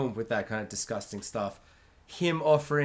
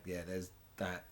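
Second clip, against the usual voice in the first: much softer, lower dawning realization; dark realization; 8 semitones lower, 17 dB quieter than usual. Retold or imitated. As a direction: let it sink in grimly. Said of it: "I'm like".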